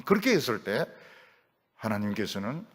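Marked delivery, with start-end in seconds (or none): none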